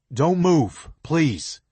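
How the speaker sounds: loud, high pleading shout; shouting a warning down the cliff; urgent shout. A narrator's reading. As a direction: stop her with your voice. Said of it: "I shout".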